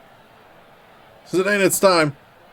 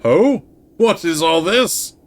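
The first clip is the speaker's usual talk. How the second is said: theatrical character voice; 4 semitones higher than usual. Acted. Voicing a game character.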